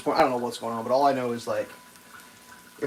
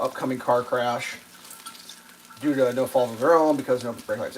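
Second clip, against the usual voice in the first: ordinary talk, nothing marked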